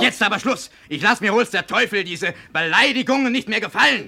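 loud, high, fed-up yelling; angry shout. Acted. Son yells at his insulting father, furious. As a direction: explode with rage. Heated quarrel, losing patience.

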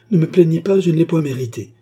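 high, loud, spirited refusal; vehement protest. A narrator's reading. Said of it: "dit-elle avec vivacité".

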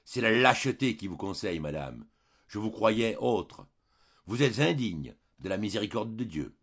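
plain reading voice

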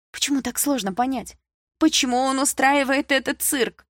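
high, pleading indignation; aggrieved protest, slowing at the end; exasperated questioning. A narrator's reading. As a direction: demand to know why, frustrated. Upset daughter keeps protesting.

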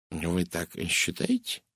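slow, soft murmured question; murmuring. A narrator's reading. Poirot questions Miss Brewster's claim, murmuring. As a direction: murmur it slowly, half to yourself. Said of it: "пробормотал Эркюль Пуаро".

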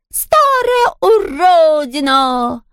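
high, drawn-out falsetto insult; breaking into falsetto. A narrator's reading. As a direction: start very high and stretch the insult. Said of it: "срываясь на фальцет".

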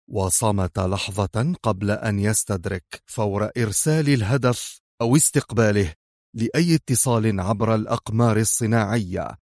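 plain reading voice

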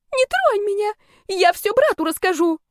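high squealed threat; frightened wail. A narrator's reading. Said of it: "С этим воплем".

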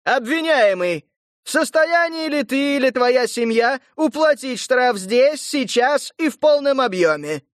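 ringing official question; town crier. Acted. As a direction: demand an answer from the accused. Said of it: "звонкий голос глашатая".

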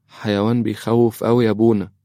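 put-on high-pitched child's voice; little girl. Daughter tells the priest about a scary animal, child voice. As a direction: raise the pitch into a young girl's.

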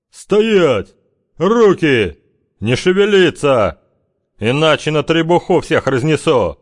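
резкий командный крик, выше и громче; рявкни приказ во всю глотку; громкий приказ. A narrator's reading. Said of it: "лужёная глотка Смыкова". (громовой командный окрик)

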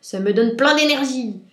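big-cat outburst of energy; roaring lion. A narrator's reading. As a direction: bellow it like an invigorated lion. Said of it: "rugit le lion".

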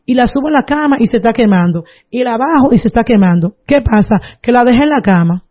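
ordinary delivery

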